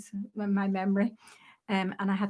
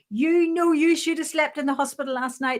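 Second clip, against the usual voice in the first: loud, higher-pitched scolding tirade; guldering telling-off; 5 semitones higher, 6 dB louder than usual. Retold or imitated. Ward sister scolding nurse who slept out, loudly. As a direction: imitate a sister berating a junior. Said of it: "It was a gulder down the ward".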